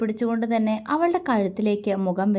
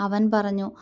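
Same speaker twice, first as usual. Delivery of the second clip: ordinary talk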